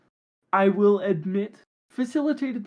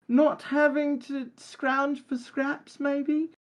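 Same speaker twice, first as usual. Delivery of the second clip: higher voice, a lightly voiced Cockney-posh elf blend; Farthing's voice; 6 semitones higher than usual. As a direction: answer in character as the scrappy elf rogue. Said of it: "Farthing, do you think money can buy happiness?".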